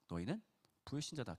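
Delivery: hushed stern judgment; quiet verdict. Retold or imitated. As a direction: say it softly like a sentence passed. Jesus quoted in quiet verdict.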